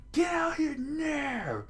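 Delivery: high, drawn-out shout; strained high furious yell; old man screaming. Acted. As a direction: scream a challenge from the street. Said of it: "the old man screamed out".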